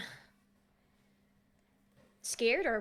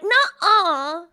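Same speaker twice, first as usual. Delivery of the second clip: loud, high foot-stamping denial; petulant child character; 5 semitones higher, 11 dB louder than usual. Acted. Totoi kicking the ground at a playmate, petulant. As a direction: refuse like a kid mid-tantrum. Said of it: "Totoi, like, kicks his, like, foot".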